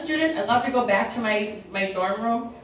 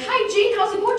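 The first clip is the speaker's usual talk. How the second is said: much higher, chirpy hello to a patient; bright high greeting; 13 semitones higher, 4 dB louder than usual. Retold or imitated. Greeting her Alzheimer's patient Jean, chirpy and much higher. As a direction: call out a cheerful hi.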